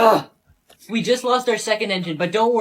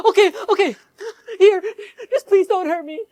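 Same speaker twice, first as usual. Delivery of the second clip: much higher, pleading voice; frightened plea; 10 semitones higher, 3 dB louder than usual. Acted. Victim to robber, scared pleading. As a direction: beg them not to shoot.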